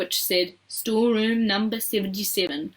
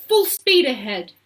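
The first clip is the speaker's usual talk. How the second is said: excited, louder call; shouted command; 4 semitones higher, 6 dB louder than usual. A narrator's reading. Mister Wonka urging the rowers into the tunnel, shouted. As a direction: urge the crew on loudly. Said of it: "shouted Mister Wonka, jumping up".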